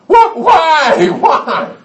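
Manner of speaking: very high, loud hammed-up whine; wailing child. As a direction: cry out in mock outrage. Child protests coming spanking.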